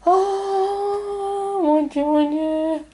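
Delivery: shocked by what just came out; startled gasp. Real, not acted. Seeing the next card, she cries out in real surprise.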